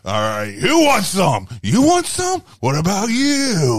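loud, high shouted taunt; hammed-up action hero bellow; cocky challenge. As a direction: yell it out to challenge a whole crowd. Acts out Ash challenging the crowd.

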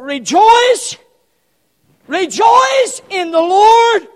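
loud, high cries of rejoice; exhorting preacher. Real, not acted. Preacher urging congregation with scripture, shouted exhortation. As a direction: belt each rejoice, then ease off.

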